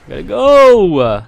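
drawn-out panicked yell, high and loud. Real, not acted. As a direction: yell it while running for your life. Panicked flight from enemies.

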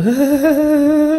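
high squeaky goofy giggle; imitated silly laugh. Mimicking the nerdy guy's silly laugh. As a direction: giggle like an awkward teen boy. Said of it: "lacht so".

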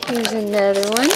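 ordinary talk

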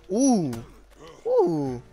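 normal talking voice

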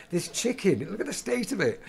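high-pitched comic grumble; indignant complaint. Acted. Diner complaining to waiter about chicken, indignant.

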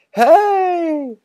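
put-on high, loud thrilled boy's yell; high boy's whoop. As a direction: cry out in delight. Boys yelling as kite takes off.

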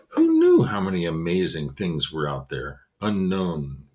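usual speaking voice

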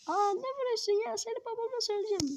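put-on a hurt kid threatening to tell dad; whiny kid voice. The struck kid whining he'll tell his father, high and fast.

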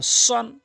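সন with round mouth and dark heavy s. Shown as a demonstration. Teacher contrasts two letters, heavy round সন. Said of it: "সৎটি মুখ গোলাকার হবে".

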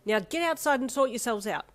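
high, loud scolding order; exasperated, forceful command; snapped. A narrator's reading. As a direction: send them out crossly. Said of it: "she snapped".

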